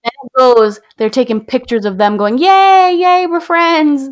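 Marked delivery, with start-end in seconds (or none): 2.4-4.1 high, slow mock celebration; sing-song fake cheer of new friends; hammed-up giddy yay. Mocking celebrating characters.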